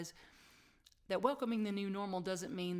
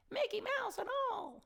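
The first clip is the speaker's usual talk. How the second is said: very high cartoon squeak; Mickey Mouse. Returns to the cartoon voice.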